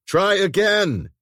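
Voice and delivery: loud, slow yell of encouragement. A narrator's reading. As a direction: shout it to push her on. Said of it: "he yelled".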